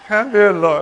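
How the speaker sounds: a playful send-up of the husband's call; mock husband. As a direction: ham up the call. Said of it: "they can't even imagine that".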